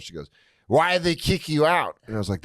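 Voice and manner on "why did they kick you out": higher mock drunk-lady voice. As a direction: imitate a drunk woman's upset question. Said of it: "this drunk lady walks up".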